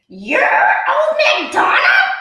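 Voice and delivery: very high, loud startled cry; surprised witch. A narrator's reading. Witch surprised to meet Old MacDonald. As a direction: squawk it in disbelief.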